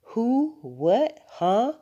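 high-pitched, rising one-word questions; puzzled mother caught off guard; startled questions. A narrator's reading. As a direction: echo the bafflement, each word jumping up. Mother startled by cat idea.

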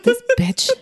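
squeaky high disbelief; high incredulous voice. Retold or imitated. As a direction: pitch it way up in disbelief. Exasperated retold reaction.

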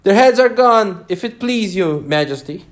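high shout easing off; shouted reply. A narrator's reading. Soldiers shout their report to the Queen. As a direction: start loud and high, then settle. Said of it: "the soldiers shouted in reply".

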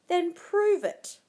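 loud, high, drawn-out challenge; hissed. A narrator's reading. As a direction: throw down a fierce dare. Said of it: "Lady Macbeth hissed".

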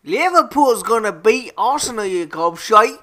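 loud, high Scouse football jeer; mock Liverpool accent. Co-host taunts Arsenal in a loud put-on Scouse accent. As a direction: bellow it like a Liverpool fan in Scouse. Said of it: "my offensive accents".

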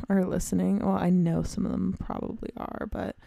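normal talking voice

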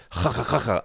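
throaty kh syllables mocking an Israeli accent; generic mock Israeli accent. Mocking actors putting on accents, guttural fake Israeli sound. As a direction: scrape out a caricatured Israeli accent in nonsense syllables. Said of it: "put on a generic".

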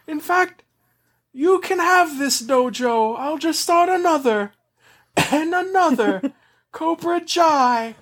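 high, oily, gloating villain voice; silky polite villain. Acted. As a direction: savor each word like a smug villain. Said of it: "that's just how he talks now too".

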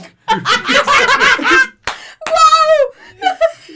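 high, loud laughing burst; loud laughter. Real, not acted. Teller laughing hard at the joke. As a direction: let the laugh ride high.

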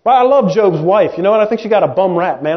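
ordinary delivery

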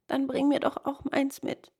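lightly voiced ferrywoman; ferrywoman requesting. A narrator's reading. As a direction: ask a favour in a lifted voice.

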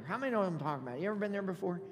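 normal talking voice

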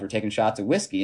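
ordinary talk, nothing marked

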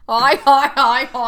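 Australian laugh. Retold or imitated. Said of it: "How do you laugh in Australian?".

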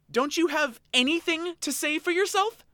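high, loud snarling demand; snarled. Acted. Oshawott demanding an answer from Elaine, high loud snarl. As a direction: snap the question at her, high and loud. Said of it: "The Oshawott snarled".

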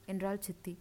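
ordinary delivery, nothing marked

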